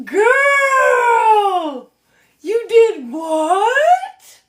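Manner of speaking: shrieking, laughing shock; scandalized. Real, not acted. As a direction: stretch "what" out in disbelief. Scandalized reaction.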